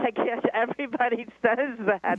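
high, laughing disbelief. Real, not acted. Caller hearing she is too invasive, laughing in disbelief. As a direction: say it through rising laughter.

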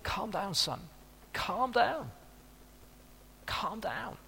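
hushed dad settling an eager son; calming a son. Retold or imitated. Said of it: "wanted to say".

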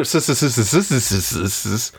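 stuttered run of sibilant s sounds; mock serpent stammer; playful snake hiss. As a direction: hiss the s over and over. Imitating hiss before naming Sir Hiss.

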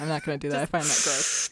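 ordinary delivery

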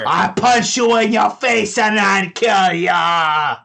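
high, loud, slow threat; slow threatening halfling. Acted. Halfling threatening to punch the player, slow loud high menace. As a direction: spell out the threat, dragging each word.

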